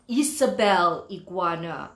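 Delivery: ordinary delivery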